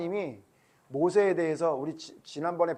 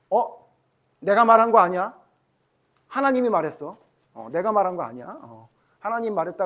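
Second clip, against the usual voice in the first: loud, higher-pitched self-excusing; acted disclaiming; 4 semitones higher, 8 dB louder than usual. Pastor imagines blaming God for the decision, mock self-excusing. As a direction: deny responsibility in a raised, louder voice. Pastor acts out shifting the blame.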